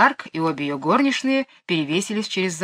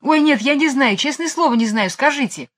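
высоко, горячо оправдываясь; клянётся, что не знает; оправдывается; 7 semitones higher, 4 dB louder than usual. Acted. Майкл оправдывается и просит. (Майкл уверяет Мэри Поппинс, высоким голосом)

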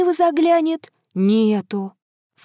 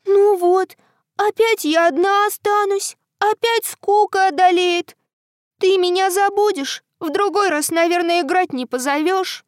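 sad moon voice; 7 semitones higher than usual. Acted. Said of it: "Луна начала горевать".

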